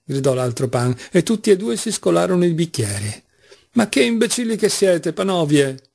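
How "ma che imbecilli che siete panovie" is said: exasperated blurt, starting high. A narrator's reading. Mitya insults the two Poles unthinkingly, blurting it out. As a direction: let it slip out, pitched up then dropping. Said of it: "sfuggì a Mitya".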